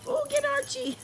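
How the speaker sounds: high squeaky urging; cheering on. Real, not acted. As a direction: egg them on up high. Cheering dogs on.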